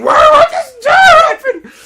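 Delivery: frantic high shriek; screaming student. Retold or imitated. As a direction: scream it way up high at full volume. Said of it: "And she's still screaming".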